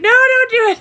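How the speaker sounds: high, loud mock plea; pleading mouth. Voicing the man's reluctant mouth, high mock protest. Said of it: "his mouth is ... being like".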